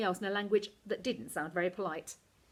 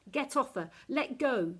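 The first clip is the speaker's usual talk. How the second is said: put-on boy yells, louder and higher; yelling; 6 semitones higher, 3 dB louder than usual. A narrator's reading. Cliff yells at Esther to let go, loud. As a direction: shout at her to let go. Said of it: "yelled Cliff".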